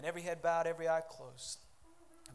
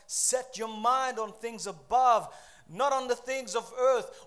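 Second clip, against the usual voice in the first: scripture read out loud and high, building; slow, emphatic pulpit reading; forceful preaching; 4 semitones higher, 9 dB louder, slower than usual. Acted. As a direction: preach the verse with rising force. Reads scripture in preaching style.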